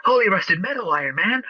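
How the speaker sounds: high-pitched sidekick exclamation; eager boy wonder. Acted. Podcaster parodies Spider-Man as Iron Man's sidekick, boy-wonder voice. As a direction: gasp it like an excitable young sidekick. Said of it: "boy wonder".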